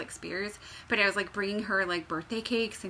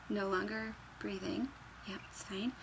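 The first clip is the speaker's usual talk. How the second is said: conspiratorial whisper; 9 dB quieter than usual. Acted. Dark joke whispered.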